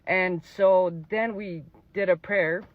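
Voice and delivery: holding back real tears as he speaks; choked up, pushing each word out slowly; fighting back tears. Real, not acted. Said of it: "Sorry, I'm trying not to cry".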